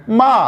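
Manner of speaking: मा falling sharply from high; fourth tone ma. Shown as a demonstration. Teacher demonstrating the fourth tone, falling मा. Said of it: "ऊपर से नीचे आते हुए वो है".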